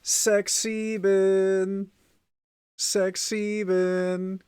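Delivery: high, very drawn-out meme chant; hammed-up high German youngster voice; mock German Gen Alpha. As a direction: stretch it out like a silly teen catchphrase. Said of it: "German Gen Alphas be like".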